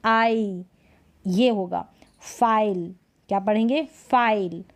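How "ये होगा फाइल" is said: usual speaking voice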